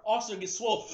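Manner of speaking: ordinary talk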